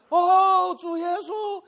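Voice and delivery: high shouted call of Lord Jesus; fervent high shouting call. Retold or imitated. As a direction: cry it out like the church elders. Child imitating the calling.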